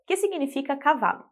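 ordinary talk

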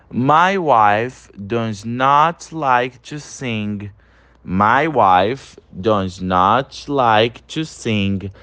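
each English word said very slowly and evenly; very slow model reading. Shown as a demonstration. Teacher reading an example for students to repeat, very slowly. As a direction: read it word by word for repetition. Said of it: "Olha o terceiro exemplo aí".

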